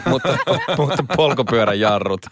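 amused, laughing through the words. Real, not acted. Laughs while quoting a funny line. (naurua pidätellen)

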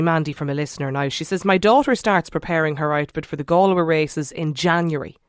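normal talking voice

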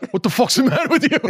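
high, fast mock challenge with a laugh in it; mock aggressive. Guest voicing what listeners think they mean, mock aggressive. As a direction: play-act a confrontational New Yorker. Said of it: "it's also kind of an aggressive accent".

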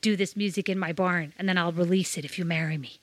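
lightly put-on low American accent. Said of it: "that was my American accent".

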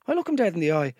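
usual speaking voice

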